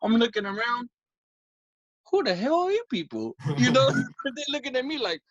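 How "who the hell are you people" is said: higher, baffled disbelief at strangers; who-are-these-people bewilderment. Retold or imitated. Looking around at strangers at the party, baffled.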